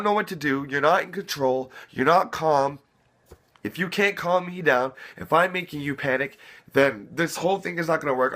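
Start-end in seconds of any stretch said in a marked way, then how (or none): none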